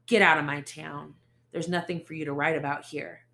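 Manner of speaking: loud menacing order; loud threat. Acted. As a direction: push your face in hers. Ace face close, threatening.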